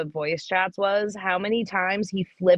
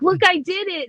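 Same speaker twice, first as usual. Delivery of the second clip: put-on high, eager kid voice; proud little kid; 12 semitones higher than usual. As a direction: play the proud toddler showing off. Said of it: "and they're like".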